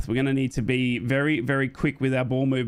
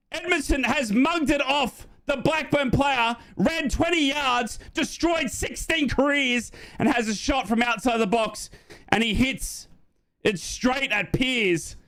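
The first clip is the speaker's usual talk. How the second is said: high, slow, drawn-out awe; excited commentary; 10 semitones higher, slower than usual. Real, not acted. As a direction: relive the near goal, stretching each phrase high. Live commentary, recapping big chance.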